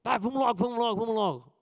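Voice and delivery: impatient hurry. Retold or imitated. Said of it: "com furor da igreja".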